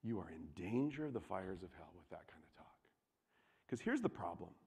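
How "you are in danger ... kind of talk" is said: low, very soft solemn caution; hushed warning. Real, not acted. Preacher warning congregation about that kind of talk, hushed. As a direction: drop to a grave near-whisper.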